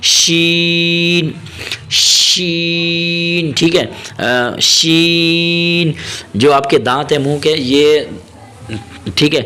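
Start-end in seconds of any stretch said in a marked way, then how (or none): 0.0-3.5 شین long, lips rounded; slow rounded-lip شین; rounded sheen. Shown as a demonstration. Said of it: "شین کی ادائیگی". 4.6-5.9 شین long, lips rounded; slow rounded-lip شین; rounded sheen. Shown as a demonstration. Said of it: "منہ کو تھوڑا سا گول کریں".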